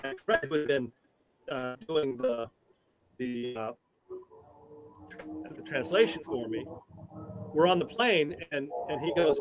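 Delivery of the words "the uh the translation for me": usual speaking voice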